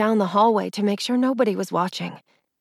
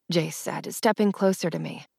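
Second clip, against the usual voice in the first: ordinary delivery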